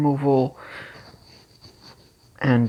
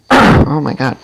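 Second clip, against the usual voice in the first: loud throat clear, then groan; 12 dB louder than usual. Real, not acted. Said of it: "Now I'm losing my voice".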